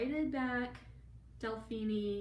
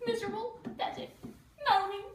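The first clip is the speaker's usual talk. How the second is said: put-on high, loud wailing ghost-girl voice; 14 semitones higher, 6 dB louder than usual. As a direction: wail it like a sulking ghost girl. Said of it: "who would you be?".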